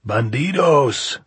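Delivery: loud, slow, high jeering shout; hoarse shout. Acted. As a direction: bellow it down the hill slowly. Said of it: "deep, hoarse voice of Sordo".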